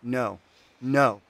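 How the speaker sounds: loud, insistent commanding no's; furious refusal. Acted. Artisan commanding the bound spirit, loud and furious. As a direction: slam down the demand.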